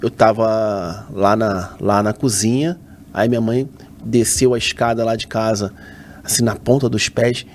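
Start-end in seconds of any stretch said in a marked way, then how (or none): none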